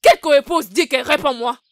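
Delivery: loud, indignant demand, repeated; a jealous wife shouting back at her husband, outraged. Acted. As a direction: throw the question back at him, furious. Wife angrily denies rival co-wife.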